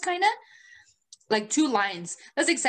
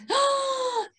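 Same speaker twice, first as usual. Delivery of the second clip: sharp high gasp of horror; grandma's startled intake of breath; shocked gasp. Retold or imitated. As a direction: gasp like you just saw a ghost.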